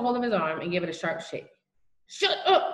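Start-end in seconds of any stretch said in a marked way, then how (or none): 2.1-2.7 urgent high-pitched hiss; tense, hissed command. A narrator's reading. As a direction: hiss a sharp warning at a giggling friend. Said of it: "he hissed".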